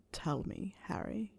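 quiet, commanding whisper; Snape. A narrator's reading. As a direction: coax very softly. Snape coaxes Harry to speak.

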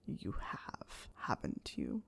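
low, very soft whisper; hushed, deep insinuation; Snape. A narrator's reading. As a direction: whisper it knowingly. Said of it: "Snape whispered".

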